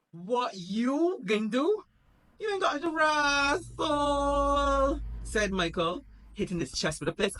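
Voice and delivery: half-crying outburst, high and loud; high tearful mock rant.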